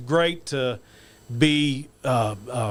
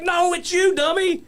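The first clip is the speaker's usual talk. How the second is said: high mock-exasperated reply; high, playful it's-you-dummy; teasing friend; slower than usual. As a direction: needle him like a buddy. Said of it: "And he's like".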